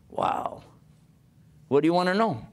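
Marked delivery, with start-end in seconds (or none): 0.1-0.6 awed whisper. Real, not acted. Awed reaction to the verse.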